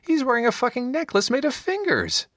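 very high-pitched incredulous outburst; exasperated cry. A narrator's reading. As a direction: cry out in appalled disbelief, pitch way up. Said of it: "Gideon cried".

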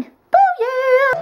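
high, loud cheer. Real, not acted. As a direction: punch it like a fan.

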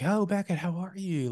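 high, gushing hello; a high, delighted "oh, Beckett"; gushing greeting. Retold or imitated. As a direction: greet him in a lifted, effusive voice. Mimicking acquaintance's greeting.

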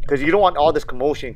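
ordinary talk

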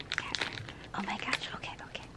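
hushed, breathless whisper. Real, not acted. Nervously opening a signed album for viewers, whispering. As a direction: whisper it so as not to break the moment.

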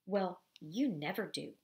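ordinary delivery, nothing marked

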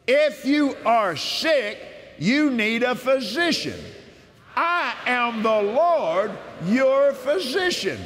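slow, solemn reading of God's promises; measured devotional scripture reading; scripture proclamation. Acted. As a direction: proclaim each verse as a promise. Reads healing scriptures aloud.

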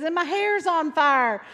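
high, loud nonsense in preaching rhythm; riled-up preacher. Retold or imitated. Preacher spouting nonsense mid-sermon, fired-up call. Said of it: "he'd start just spouting some nonsense".

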